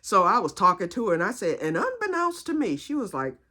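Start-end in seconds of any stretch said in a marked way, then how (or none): 1.6-2.8 high-pitched, affected elegance; trying to be fancy. Retold or imitated. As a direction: show off a fancy word in a raised voice. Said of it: "I tried to be fancy".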